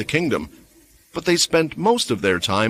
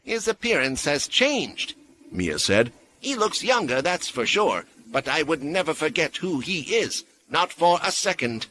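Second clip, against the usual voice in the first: high, softer woman's voice; Mia's voice; 6 semitones higher, 4 dB quieter than usual. A narrator's reading. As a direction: speak with quiet certainty. Mia speaks of Lenny.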